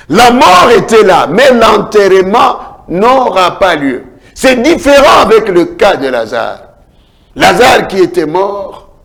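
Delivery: high, loud preacher's cry rising and falling; fiery preaching. Acted. Pastor telling grieving believers Jesus says do not weep, fiery preaching. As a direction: preach it with full force to the congregation.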